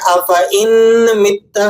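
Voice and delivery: افئن مت with an audible ن, slow; wrong reading. Shown as a demonstration. Teacher imitates the error again, slow mistaken reading. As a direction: stretch out افئن مت with ن. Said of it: "جو ساتھی اسے نون کے ساتھ پڑھتے ہیں".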